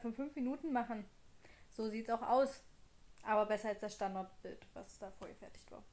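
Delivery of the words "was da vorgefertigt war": whispered aside. Real, not acted. Trailing off.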